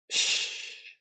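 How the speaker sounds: breathy unvoiced shush; whispered hiss. Upset Gollum shushing Bilbo, whispered hiss. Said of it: "said Gollum".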